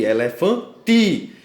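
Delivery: ELEFANTI slowed down, landing hard on TI; stress on TI. Shown as a demonstration. Teacher pointing out the tonic syllable, stress on TI. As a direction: draw out the word and punch TI. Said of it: "no caso o ti".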